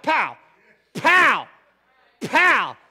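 shouted pow on each tricep pop; loud pow. Shown as a demonstration. Pastor shows congregation the tricep pop, shouting. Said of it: "pop that".

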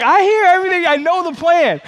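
dad teasingly calls out his son's secret plan; mock triumphant. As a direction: crow it like you caught him red-handed.

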